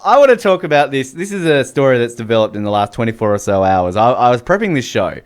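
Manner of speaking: ordinary talk, nothing marked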